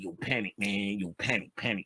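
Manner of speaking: low, cool and slick, imitating a young friend; easy street-cool call, lightly voiced; slick greeting. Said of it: "He used to say it so slick".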